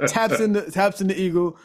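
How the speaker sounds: ordinary delivery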